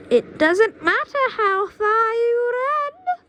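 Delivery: very high, loud, slow threat; witch calling after him. Acted. Witch calling after fleeing boy.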